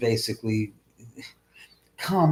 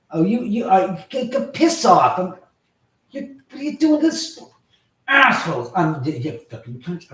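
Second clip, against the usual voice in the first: loud, higher stammering rage; furious cursing; 7 semitones higher, 6 dB louder than usual. Real, not acted. Streamer curses at whoever sabotages his stream, furious stammer. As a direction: sputter with fury at the culprits.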